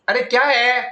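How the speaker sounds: irritated aside. Real, not acted. Teacher to whoever interrupts the recording, irritated. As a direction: break off, pestered, to someone off to the side.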